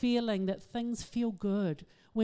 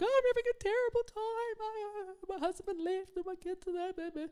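much higher, whiny mock despair; mock distressed self; 10 semitones higher, 3 dB quieter than usual. Calling a psychologist friend, high mock wailing. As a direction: send up your own wailing to the friend. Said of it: "I was like".

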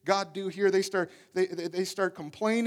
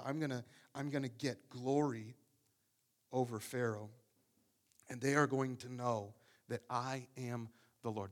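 God speaking; 11 semitones lower, 14 dB quieter than usual. Acted. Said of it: "But, but God says".

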